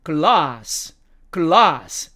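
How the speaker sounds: British "glass" with long ah; British demo of "glass"; British accent. Shown as a demonstration. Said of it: "영국식 발음으로는".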